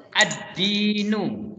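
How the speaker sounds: "Ad-dinu" slow, the d doubled, no l; correct reading. Shown as a demonstration. Teacher models the right reading, slow and careful. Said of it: "nggak boleh al-dinu, tapi ad-dinu".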